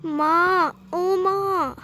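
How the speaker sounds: drawn-out, mildly acted moan for mother; pained moaning. A narrator's reading. Mohan calls his mother with a tummy ache, moaning. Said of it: "जोर से कर्राकर बोला".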